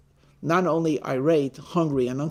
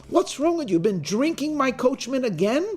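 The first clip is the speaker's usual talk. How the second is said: indignant, higher-pitched mock outrage; acted irate; 6 semitones higher than usual. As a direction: protest like an offended gentleman. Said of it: "seems very irate and says".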